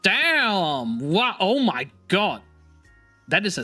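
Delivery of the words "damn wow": usual speaking voice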